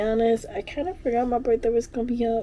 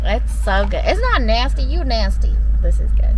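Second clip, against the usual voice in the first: playful defensive; 3 semitones higher, 10 dB louder than usual. Real, not acted. Defending the sour drink.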